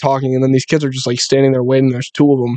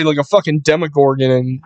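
usual speaking voice

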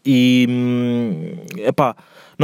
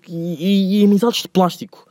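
usual speaking voice

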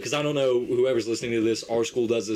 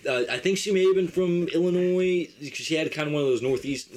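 ordinary talk

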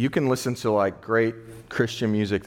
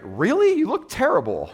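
much higher and louder, hammed-up shock; mock disbelief; 4 dB louder than usual. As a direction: play up the startled disbelief. Mock reply to fake "great.

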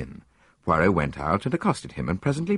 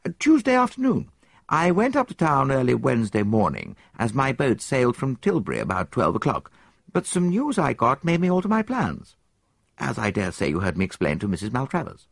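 put-on higher, quick eager young man's voice; young soldier; 7 semitones higher, 4 dB louder than usual. Soldier answers the detective, higher and quick. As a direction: play the keen young officer, pitched up.

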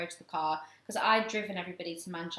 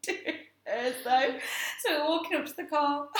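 ordinary talk, nothing marked

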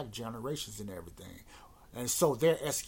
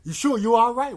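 loud, high mock concern; imitating someone baiting a stranger; provoking; 9 semitones higher, 13 dB louder than usual. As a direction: needle him with an over-loud check-in. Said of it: "trying to provoke the Indian guy".

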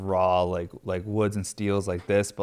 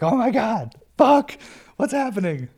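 very high-pitched, louder mock scream; acted panicked scream; 13 semitones higher, 5 dB louder than usual. Parent alone in the garage, acted panicked scream. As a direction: let out a high, panicked freak-out. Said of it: "you just scream".

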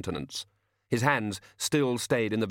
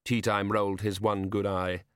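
usual speaking voice

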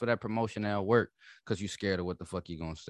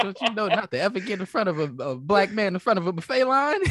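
mock quote; 11 semitones higher, 6 dB louder than usual. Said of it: "but you said".

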